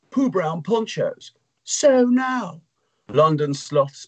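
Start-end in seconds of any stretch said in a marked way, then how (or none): 0.1-2.6 delighted squeal. Acted. Hull marvels at the ponchos.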